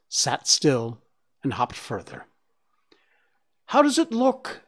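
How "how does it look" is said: high, impatient call across; "How does it look?" pitched much higher, pressing. Acted. Karis calling to the hare, high and impatient. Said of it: "Karis called to him impatiently".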